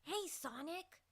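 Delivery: a hint of an eager young fox boy; Tails impression. Shown as a demonstration. Chat asks for Tails, she tries a light boy voice. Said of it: "Now do a Tails impression".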